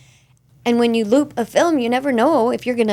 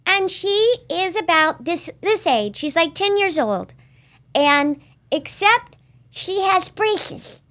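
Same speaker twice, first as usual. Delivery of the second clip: put-on much higher slow kid voice of a ten-year-old girl; Bridget voice; 8 semitones higher than usual. Showing her F is for Family kid character, high slow girl voice. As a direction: talk like a little girl with braces. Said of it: "her name's Bridget".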